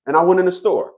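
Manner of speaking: usual speaking voice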